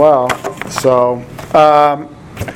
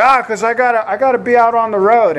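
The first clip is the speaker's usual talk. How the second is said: higher-pitched imitated country boy accent; 7 semitones higher, faster than usual. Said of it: "he sounded kind of, you know, country".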